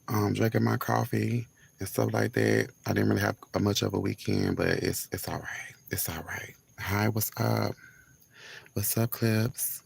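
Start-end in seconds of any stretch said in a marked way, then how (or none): 5.1-6.5 soft, hushed reassurance; quiet voice. Real, not acted. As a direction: keep it hushed so nobody hears. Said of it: "let me keep my voice this low".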